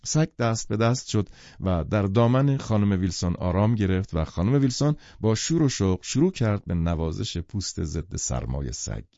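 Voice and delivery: ordinary delivery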